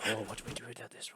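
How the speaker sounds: quick, hushed crowd whisper; low, hurried murmur of a confused crowd. Acted. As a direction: whisper it fast among yourselves. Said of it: "So the crowd's whispering".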